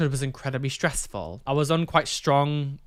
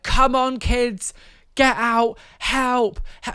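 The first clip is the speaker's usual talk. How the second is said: high, raised imitation of a frantic mother; retold panicked shouting; 9 semitones higher, 5 dB louder, slower than usual. Mum urging her kids out of the house, retold panicked shout. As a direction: call out urgently, mimicking panic. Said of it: "just started screaming".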